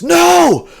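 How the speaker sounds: a very high, loud cry of no; anguished shout. Acted. Scientist seeing the blood spray, high anguished shout. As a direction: yell it out in horror. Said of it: "And he says".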